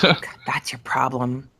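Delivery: hushed whisper aside. Real, not acted. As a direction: breathe it out under your breath. Unvoiced aside.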